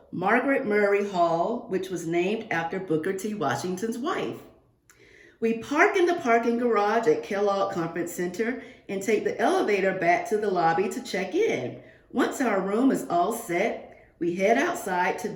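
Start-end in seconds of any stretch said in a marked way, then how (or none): none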